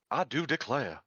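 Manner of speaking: put-on Deep South belle accent with a playful lilt; Deep South Georgia accent. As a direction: play a Georgia lady fanning herself, Deep South accent. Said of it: "Deep South Georgia".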